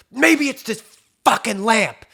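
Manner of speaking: high, loud mock rage; mad outburst. Housemate blames a lamp before slamming it, imitated furious yell. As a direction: yell it like he's lost it, imitating. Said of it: "He's so fucking mad".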